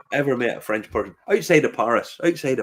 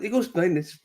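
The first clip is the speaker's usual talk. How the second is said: ordinary talk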